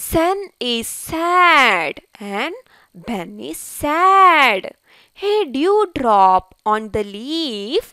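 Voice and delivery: slow, drawn-out storybook reading; slow read-aloud to children. Acted. Teacher reads the ant story to pupils, slow and deliberate. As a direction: read it very slowly for young learners.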